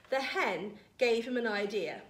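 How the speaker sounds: ordinary delivery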